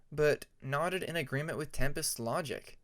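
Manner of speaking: ordinary delivery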